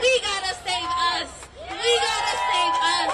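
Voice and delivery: loud, driving repeated cry; rally shout. Real, not acted. Rally speaker urging the crowd, loud shout. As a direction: rouse the crowd with it.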